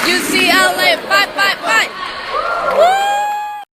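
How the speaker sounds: high, loud chant; hyped college fight cheer. Real, not acted. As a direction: yell the team cheer.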